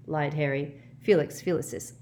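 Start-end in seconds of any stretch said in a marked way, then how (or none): none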